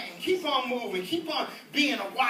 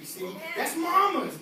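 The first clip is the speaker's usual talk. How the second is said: ordinary talk, nothing marked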